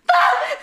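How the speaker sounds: very high comic shriek; imitated shriek. Spike seeing his wrecked ship, imitated high shriek. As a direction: let out a short, piercing mock shriek. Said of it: "You hear Spike literally shriek".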